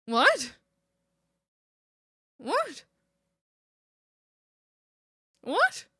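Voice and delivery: from puzzled to squeaky astonishment; growing disbelief. Real, not acted. Confused at chat, repeated three times.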